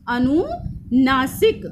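अनुनासिक drawn out at half speed; slow syllable-by-syllable. Shown as a demonstration. Teacher repeating the term for students, slowly drawn out. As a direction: stretch out अनुनासिक, part by part.

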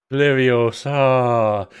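very slow, stretched incantation; mock Hermione correction; spell casting. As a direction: draw the spell out like a wizard. Repeating the spell.

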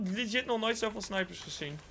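usual speaking voice

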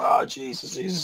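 ordinary delivery

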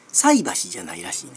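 菜箸 dropped low as the rejected form; 菜箸 pitched low, the way she says is wrong; 菜箸 in a low falling pattern; wrong intonation. Shown as a demonstration.

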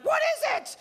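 very high-pitched frantic questioning; mock everyone going crazy to find out; hammed-up, squealing demand; crazed nosy crowd. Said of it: "That drives everyone crazy".

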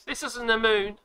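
usual speaking voice

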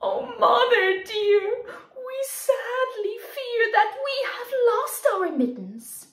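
high, loud kitten wail; hammed-up kitten crying; mock crying kittens. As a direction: whimper it like a kitten near tears. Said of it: "and soon began to cry".

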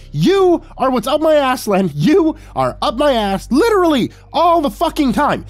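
very high, loud furious rant; hollering. A narrator's reading. Host shaking the container at guest, hollering. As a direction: holler each accusation at full pitch. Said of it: "I shook the container at him and hollered".

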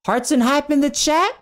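high, squealing thanks to the chat; over-the-top high-pitched shout-out; excited hype. Pretend. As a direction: cheer in a silly high voice. Streamer hyping a returning subscriber.

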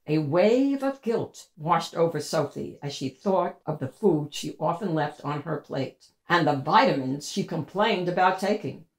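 ordinary delivery, nothing marked

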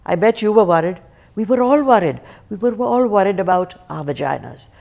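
emphatic, measured stage recitation; theatrical monologue. Acted. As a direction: open the show with a dramatic confession. Recites monologue opening to audience.

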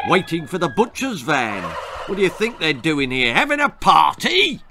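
sarcastic, climbing to a high, loud finish; scoff, building to a yelp; mocking drill sergeant; scornful sergeant. Sergeant turkey scoffs at the boy.

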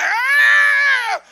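long high loud shriek; hammed-up bird scream; pained scream. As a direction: shriek at the whacks. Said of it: "She like".

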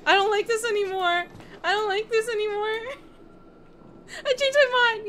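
high, loud pleading whine; frightened whining. Real, not acted. Frightened player wants to quit.

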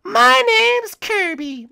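very high, slow squeaky Kirby. Acted. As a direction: say it like a tiny pink puffball. Kirby introduces himself.

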